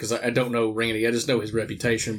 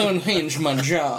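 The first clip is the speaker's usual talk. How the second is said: toothless; slower than usual. Pretend. Toothless gag.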